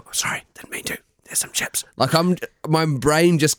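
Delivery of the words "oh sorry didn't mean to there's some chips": soft unvoiced sorry; whispered apology. Drunk partner apologising at midnight, whispered. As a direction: breathe it out so as not to wake her.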